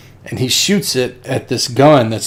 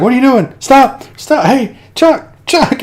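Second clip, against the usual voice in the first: high, raised, urgent shouts; mock frantic calling; 13 semitones higher, 5 dB louder than usual. Crew yelling at Chuck to stop, mock frantic. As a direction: holler as if over gunfire.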